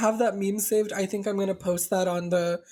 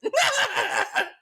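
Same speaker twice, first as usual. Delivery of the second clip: cackle laugh; 13 semitones higher than usual. Pretend. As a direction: let a big cackling laugh rip. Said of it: "it wasn't your laugh. That is his laugh".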